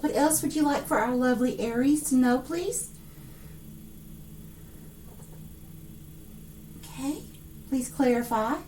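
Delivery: hushed request. Real, not acted. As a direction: ask Spirit gently, under breath.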